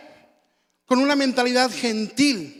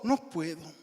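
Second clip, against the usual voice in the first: low, soft, dismissive no; reluctant refusal; 5 semitones lower, 8 dB quieter than usual. Acted. Churchgoer refusing the call to prayer, mumbled and reluctant. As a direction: brush it off under your breath.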